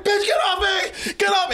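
high, frantic mock yell; imitated protest shout. Son beaten in the car, acted shout to get free. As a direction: act out a kid fighting someone off.

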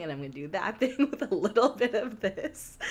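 rising pitch, breaking into laughter; laughing while reading. Real, not acted. Reading an actor's antics to a friend, laughing. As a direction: crack up as you get through the quote. Reads a quote while cracking up.

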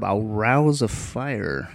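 slow, relishing each word of "I'll rouse a fire"; savored slow repeat. Shown as a demonstration. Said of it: "I love that verb".

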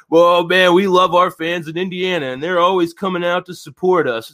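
mock the empty coach cliché; Archie Miller coach-speak. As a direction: parody a bland postgame interview voice. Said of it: "nonsense bullshit boilerplate".

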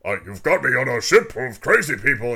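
hammed-up audition performance; Worf. Worf auditioning for Crusher, overacted delivery. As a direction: declaim it like a bad actor auditioning. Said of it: "bringing in Worf, and he's like".

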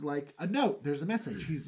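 usual speaking voice

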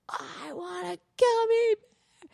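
high, drawn-out mock wail after a gasp; slow, high-pitched fake tantrum cry; imitated hysterical crying. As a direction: imitate a kid wailing for a treat. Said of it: "Hysterical crying".

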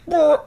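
high-pitched mouth noise; imitated deflating noise. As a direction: make a silly high "brr" sound.

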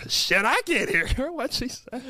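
reprise the baffled Southern grandpa, high and slow; hard-of-hearing grandpa. Acted. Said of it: "shout out to Vernon".

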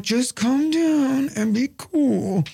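high, very drawn-out coaxing; mock-calm plea with stretched words; strained calm. Husband coaxing wife downstairs, strained calm.

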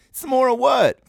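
lightly imitating the Sandlot kid, high and puzzled; Sandlot kid quote. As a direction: quote the movie kid with a mock-baffled lift. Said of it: "From Sandlot, remember?".

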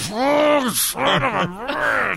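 high exasperated mock curse; frustrated opponent. Opponent kept waiting at the serve, high mock curse. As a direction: groan out the curse like a fed-up rival. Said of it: "the guy behind you's like".

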